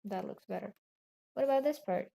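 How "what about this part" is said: ordinary talk, nothing marked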